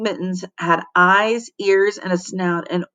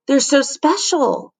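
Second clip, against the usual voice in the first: higher, admiring voice; admiring girl; 7 semitones higher than usual. Acted. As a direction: gush over the mittens.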